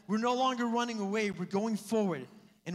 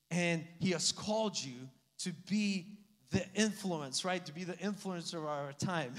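ordinary talk

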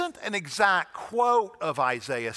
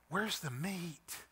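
slow, much softer echo of the line; hushed; 11 dB quieter, slower than usual. Retold or imitated. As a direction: drop nearly to a breath. Echoing the old lady line.

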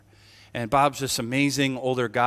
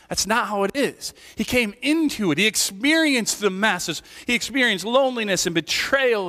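raised, driving sermon voice; fervent preaching; 8 semitones higher than usual. Acted. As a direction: preach it out to the pews with force. Preaching to congregation.

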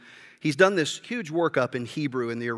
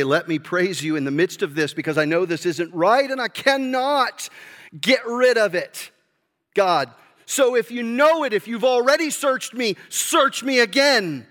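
pleading prayer, louder and higher, building to a cry; impassioned plea; 5 semitones higher, 4 dB louder than usual. Retold or imitated. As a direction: cry out to God like a desperate psalmist, slowing on the last words. Preacher voicing David's desperate prayer.

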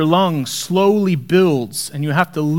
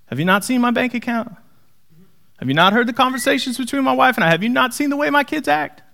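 high, mocking disbelief with a laugh; hint of a sarcastic disciple; incredulous; 6 semitones higher than usual. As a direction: play the exasperated worrier. Mock disciple thoughts, laughing.

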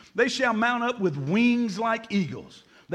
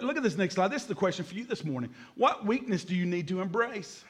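ordinary delivery

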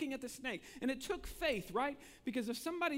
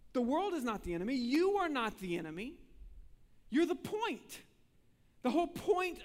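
rising, louder, higher preacher's appeal; preaching at full force; 5 semitones higher, 3 dB louder than usual. Acted. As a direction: lift it to a fervent climax. Preacher builds to climax.

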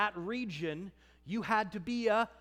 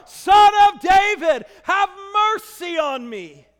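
shouted desperate plea, much louder; crying out louder; 8 semitones higher, 15 dB louder than usual. Acted. Blind man crying out over the crowd, full shout. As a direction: cry out with everything. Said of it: "He cried out all the more".

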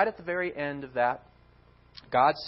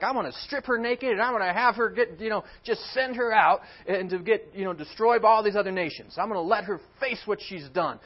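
loud, high, angry threats; furious threat; 7 semitones higher, 7 dB louder than usual. Acted. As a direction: rage at the unfaithful bride. God threatening unfaithful bride.

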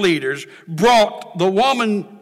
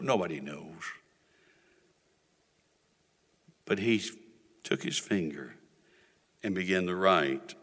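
low, soft storytelling with long pauses; hushed preaching; 10 semitones lower, 8 dB quieter than usual. Real, not acted. Preacher telling of Jesus writing on the ground, hushed. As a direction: drop right down and let it hang.

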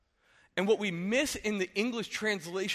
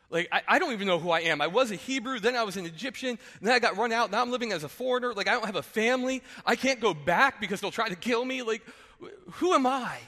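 higher, louder, pleading and flustered; desperate Moses; 5 semitones higher, 5 dB louder than usual. Retold or imitated. Moses pleading with God about who he is, higher and frantic. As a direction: Moses spilling over in panic. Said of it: "Moses is looking at God and going".

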